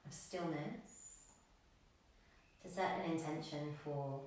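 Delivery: soft, low-key yoga meditation voice with long pauses; guided meditation. Acted. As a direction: gently guide the class into stillness, leaving room to breathe. Leads a breathing meditation.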